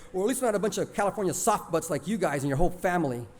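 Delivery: ordinary delivery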